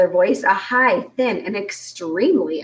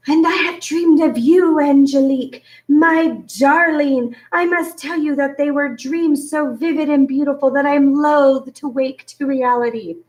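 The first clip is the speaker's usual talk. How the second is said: romantic hero voice; 6 semitones higher than usual. A narrator's reading. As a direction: gush like a lovesick hero. Spiver acting romantic Federico.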